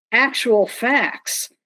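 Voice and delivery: slow, deliberate, ironic emphasis; drawn out, tongue in cheek; mock-careful slow. Real, not acted. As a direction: deliver it slowly as a supposedly shocking revelation. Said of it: "I'll say this slowly".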